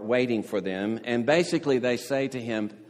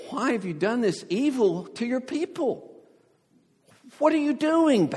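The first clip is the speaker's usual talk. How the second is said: pleading; 12 semitones higher than usual. Retold or imitated. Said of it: "to Yahweh and says".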